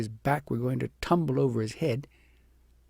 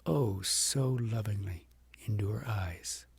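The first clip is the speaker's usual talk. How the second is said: usual speaking voice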